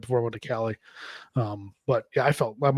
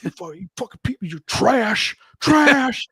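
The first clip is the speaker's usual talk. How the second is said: insulting yell that climbs higher and louder to the last "trash"; mock a vendor screaming insults through a speaker; imitated megaphone yelling; 8 semitones higher, 4 dB louder than usual. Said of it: "on the megaphone or whatever, like, yelling at us".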